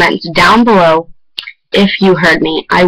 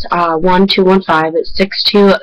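ordinary talk, nothing marked

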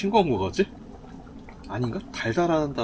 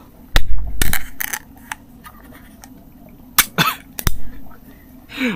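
숨넘어가게 높은 웃음소리; 참지 못하고 터지는 폭소; 15 dB louder than usual. Real, not acted. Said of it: "아, 웃겼다". (숨 넘어가는 폭소)

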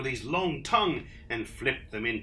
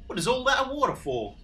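high, quick character voice; platypus character; 11 semitones higher, 4 dB louder, faster than usual. A narrator's reading. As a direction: ask it fast and high as a storybook platypus. Voicing Platypus in the story.

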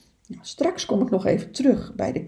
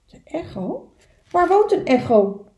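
little beaver voice; 7 semitones higher, 5 dB louder than usual. Acted. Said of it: "vroeg Kleine Bever".

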